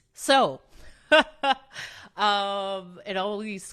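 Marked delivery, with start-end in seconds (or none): 0.8-2.1 short burst of loud laughter. Real, not acted. Speaker breaking off after "So", loud laugh.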